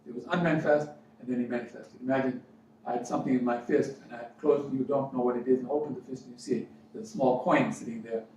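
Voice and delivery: ordinary delivery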